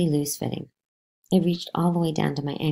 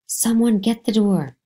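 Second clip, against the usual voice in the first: loud, higher, slow yell; 8 semitones higher, 7 dB louder, slower than usual. Acted. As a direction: yell to the house to stop the boy. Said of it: "she yelled".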